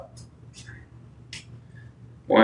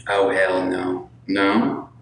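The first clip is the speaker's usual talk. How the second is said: normal talking voice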